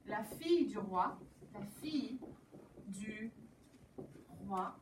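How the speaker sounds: normal talking voice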